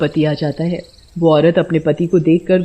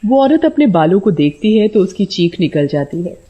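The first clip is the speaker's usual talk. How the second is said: ordinary delivery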